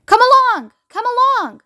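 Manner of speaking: high, hurrying call; brisk rallying cry, pitch lifted. A narrator's reading. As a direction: call everyone to attention. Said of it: "he called out".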